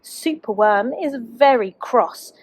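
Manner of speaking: plain reading voice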